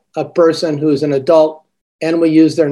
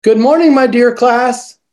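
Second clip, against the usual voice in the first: bright teacher greeting; 6 semitones higher than usual. Shown as a demonstration. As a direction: greet the whole class with lifted cheer. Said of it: "Not too bad".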